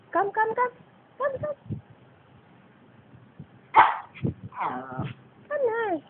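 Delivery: high little pet-calling voice; repeated high calls urging it over; coaxing. Real, not acted. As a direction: coax a pet closer, high and light.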